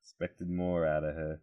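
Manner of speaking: put-on soft, low Boston-accent disappointment. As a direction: quietly imitate a let-down dad from Boston. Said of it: "disappointed father in a T V sitcom".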